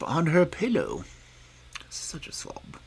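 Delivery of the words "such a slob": a quick unvoiced whisper to the listener; whispered aside.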